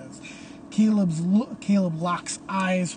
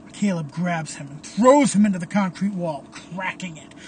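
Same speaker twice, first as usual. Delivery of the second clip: plain reading voice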